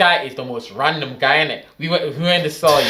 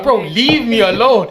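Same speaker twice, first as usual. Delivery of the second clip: exasperated plea; 9 semitones higher, 6 dB louder, slower than usual. Retold or imitated. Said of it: "I'm like".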